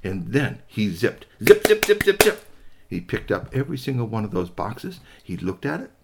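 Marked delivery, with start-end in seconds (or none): 1.4-2.5 high, loud rapid zips; quick zip sounds. A narrator's reading. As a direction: make each zip snappy.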